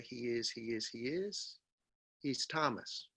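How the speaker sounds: "He is" kept light, then weight on "Thomas"; stress on Thomas. Shown as a demonstration. Teacher models for students where the stress falls on "Thomas". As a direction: land the stress on "Thomas", not on "is". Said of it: "You hear Thomas is the emphasis".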